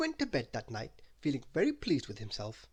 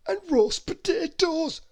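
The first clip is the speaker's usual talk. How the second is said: high, slow, louder greedy voice; Mister Greedy, shrugging off a cake, in a high slow voice; greedy character voice; 9 dB louder, slower than usual. Acted.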